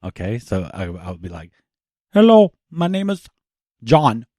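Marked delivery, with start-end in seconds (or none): 2.1-4.3 put-on Indian accent. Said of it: "can you do Indian accent".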